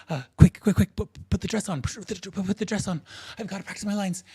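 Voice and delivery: high-pitched, stammering hurry; acted frantic urgency, tripping over words; flustered rush. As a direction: rattle it out in backstage panic. Flustered actor hurrying backstage.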